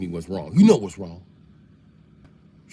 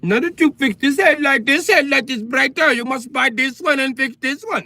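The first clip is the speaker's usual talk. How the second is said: put-on Indian accent; 7 semitones higher than usual. Indian woman complaining about headlights.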